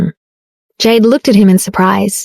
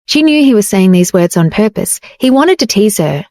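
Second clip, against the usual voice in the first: usual speaking voice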